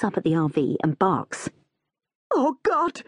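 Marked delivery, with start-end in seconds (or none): 2.3-3.0 high-pitched melodramatic anguish; anguished cry. A narrator's reading. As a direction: wail it in acted dread. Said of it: "Janice emits an anguished cry".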